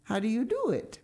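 put-on a friendly neighbour girl, frank and glad; Diana, frank. A narrator's reading. Diana asks.

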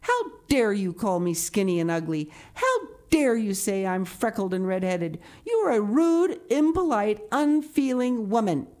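put-on furious child telling off an adult; passionate indignation. A narrator's reading. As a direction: build to hammered, high-pitched accusations. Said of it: "passionate indignation".

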